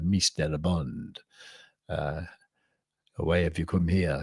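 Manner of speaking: lightly voiced Eastern European seductress; mock Eastern European accent.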